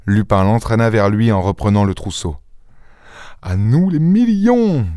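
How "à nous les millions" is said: lent, très aigu, savouré comme une victoire; triomphe. Acted. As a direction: savourer le butin, lentement et haut perché. Lupin triomphe devant le coffre. (voix triomphante, lente et aiguë)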